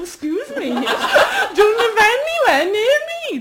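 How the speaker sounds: ordinary talk, nothing marked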